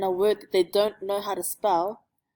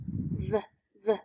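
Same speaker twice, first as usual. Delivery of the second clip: usual speaking voice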